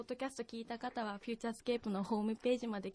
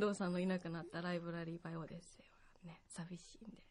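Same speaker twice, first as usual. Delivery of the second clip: soft sad whisper; 5 semitones lower, 6 dB quieter than usual. Real, not acted. Guest says goodbye, sad.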